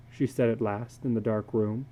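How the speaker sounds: plain reading voice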